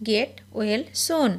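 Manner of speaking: slow and deliberate; slow repeat. Shown as a demonstration. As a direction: stretch each word out for learners. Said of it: "teacher repeats it slowly".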